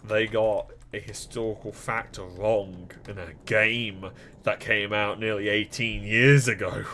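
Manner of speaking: usual speaking voice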